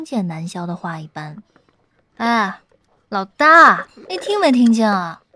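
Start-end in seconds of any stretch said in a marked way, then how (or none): 2.2-5.2 loud, helpless pestering; exasperated follow-up. A narrator's reading. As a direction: call out to someone who isn't listening. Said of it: "南萧无奈地追问道".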